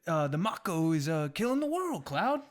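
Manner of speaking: urgent plea to Cloud, half acted; mock dramatic warning; game character line. Continuing the game character quote.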